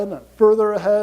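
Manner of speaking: ordinary talk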